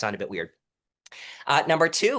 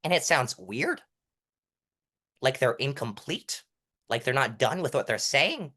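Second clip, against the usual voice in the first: "weird", "incomplete" and "saying" each ending on a rise; upward endings. Shown as a demonstration. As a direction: finish "weird", "incomplete", "saying" going up, as if unfinished. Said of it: "end going up on a sentence".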